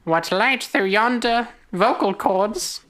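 slow, high theatrical squeak; mock Shakespearean. Spike wondering at his voice, mock-Shakespearean squeak. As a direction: declaim it slowly like squeaky Shakespeare.

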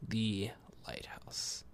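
slow unvoiced whisper; eerie whisper. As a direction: breathe it out like a secret. Whispered title repeat.

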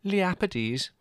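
high, light offhand reply; airily. A narrator's reading. Margot naming the far destination, airy. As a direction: brush it off lightly. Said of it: "said Margot airily".

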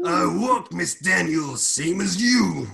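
high macho wrestler voice; Randy Savage. Acted. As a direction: play the old man as a hammed-up wrestler. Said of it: "Randy Savage's voice".

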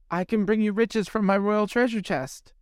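put-on high, hopeful girlish voice; eager young mermaid. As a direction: offer your treasure brightly and high. Mermaid offering payment.

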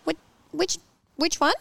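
high-pitched, flustered questioning; confused mum. Retold or imitated. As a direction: imitate a bewildered mum asking for directions. Imitating confused mum during class.

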